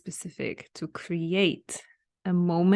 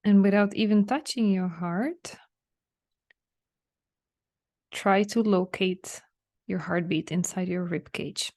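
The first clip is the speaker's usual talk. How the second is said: unhurried relaxation guide with long pauses; spacious, measured meditation lead; guided meditation. Real, not acted. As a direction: guide them gently inward, leaving room to breathe. Leads listeners through a relaxation meditation.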